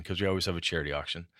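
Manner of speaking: ordinary talk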